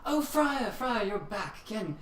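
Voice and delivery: high, cheery barkeep welcome; high chirpy innkeeper calling a friend back; bartender greeting. Acted. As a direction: sing out a hearty hello to a regular. GM voices bartender greeting friar.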